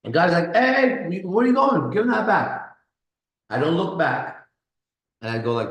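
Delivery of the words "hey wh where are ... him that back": raised, higher-pitched call after someone. Retold or imitated. Bar patron to a man walking off, calling out. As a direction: imitate an objecting bystander calling out.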